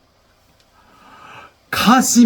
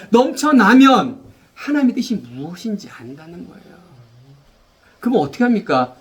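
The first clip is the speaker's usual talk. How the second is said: normal talking voice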